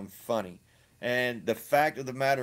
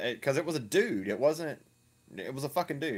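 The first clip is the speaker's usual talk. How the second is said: ordinary delivery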